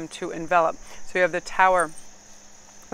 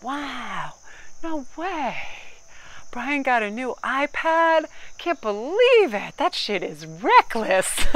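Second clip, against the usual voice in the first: high, slow, gushing mock amazement; fake enthusiasm; 6 semitones higher, much slower than usual. Shown as a demonstration. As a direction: overplay being impressed by something dull. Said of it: "be like".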